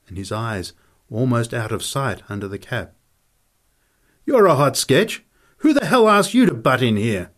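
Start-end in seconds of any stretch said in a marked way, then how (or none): 4.3-7.3 loud, raised-pitch scornful challenge; angry tirade. Acted. Fighter demands who asked Nick in, loudly angry. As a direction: round on the intruder angrily.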